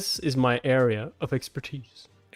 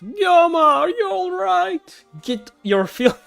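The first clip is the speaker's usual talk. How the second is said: high, slow, hammed-up worried cry; squeaky melodramatic concern; worried heroine; 7 dB louder, slower than usual. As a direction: overact a frantic girl calling her friend. Voicing a character's worried cry.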